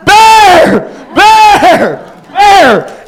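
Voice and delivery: high, loud calling scream; mom screaming. Retold or imitated. Mom yelling after her son riding off, high scream. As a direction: scream his name across the woods. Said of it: "she's screaming at me in the campground".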